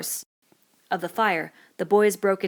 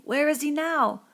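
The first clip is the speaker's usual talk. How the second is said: put-on a frightened boy, pleading; Piggy distraught; 9 semitones higher, 3 dB louder than usual. Piggy telling the boys the little un is gone, distraught. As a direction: insist with rising fear.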